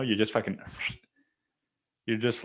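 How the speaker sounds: usual speaking voice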